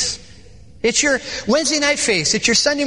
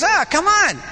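higher-pitched mock exasperation; exasperated protest; 7 semitones higher than usual. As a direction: protest like you've been caught out. Caught-out [name] protests to driver.

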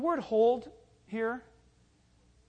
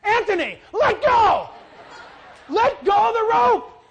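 high, loud desperate shout; urgent yell; 11 semitones higher, 13 dB louder than usual. Retold or imitated. Pastor shouting at the boy dragged behind, urgent yell. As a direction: yell at him to let go.

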